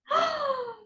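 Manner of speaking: high, drawn-in storyteller's gasp; dramatic gasp. Pretend. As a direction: gasp for the listening child. Suspense before the dog acts.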